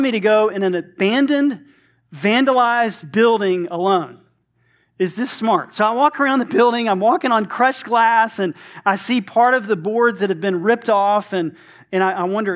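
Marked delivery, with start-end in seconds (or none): none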